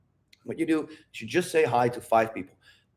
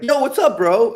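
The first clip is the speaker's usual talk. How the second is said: hyped greeting; 8 semitones higher, 6 dB louder than usual. Retold or imitated. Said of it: "You're like".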